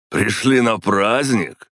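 put-on high, slow drunken cheer; tipsy man raising a toast. A narrator's reading. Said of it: "раздался пьяный голос Орлова".

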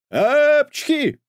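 huge high loud sneeze; booming cartoon sneeze; thunderous sneeze. A narrator's reading. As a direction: sneeze so the house shakes. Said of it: "громом разнеслось по дому".

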